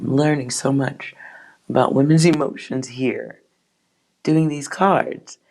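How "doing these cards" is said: ordinary delivery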